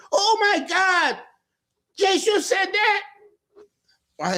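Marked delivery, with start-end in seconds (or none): 0.1-3.0 much higher and slow, scandalized; mother shocked at son swearing, high and drawn out. Retold or imitated. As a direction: play the mother stunned by her son's words. Mother shocked at son's language.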